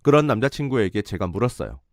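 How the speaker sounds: ordinary delivery, nothing marked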